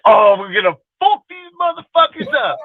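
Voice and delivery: high-pitched, pumped-up cheer; hyped fan yell. Retold or imitated. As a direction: shout it like the game's already won. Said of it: "I was like".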